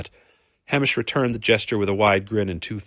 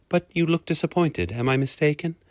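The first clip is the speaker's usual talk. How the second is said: higher, questioning lady's voice; puzzled lady; 7 semitones higher than usual. Acted. Lady notices Hamish's disappointment, puzzled and higher. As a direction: ask it high, unsure of his reaction.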